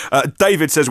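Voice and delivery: ordinary talk, nothing marked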